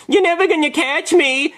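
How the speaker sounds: put-on high, taunting child voice; mock a giggly kid's taunt; kid yelling. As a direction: sing out high like a teasing child. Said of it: "he yells".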